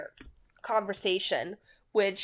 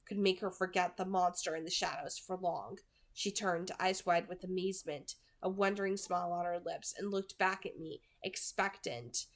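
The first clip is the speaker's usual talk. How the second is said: soft, even audiobook reading; hushed novel narration, measured and soft; story narration; 6 dB quieter than usual. A narrator's reading. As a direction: read the chapter quietly to listeners. Reads a novel chapter aloud.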